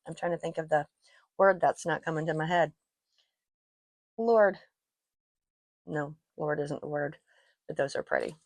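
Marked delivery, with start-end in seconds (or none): none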